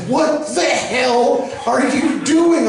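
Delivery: high, slow, outraged scolding; hammed-up spousal telling-off; imitated scolding wife. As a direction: draw out each word like an appalled wife. Wife scolds him for hitting kid.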